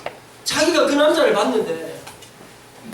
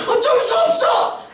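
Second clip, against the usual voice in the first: much higher, slow laughing exclamation; laughing shout; 11 semitones higher, slower than usual. Real, not acted. Preacher exclaims to the girls.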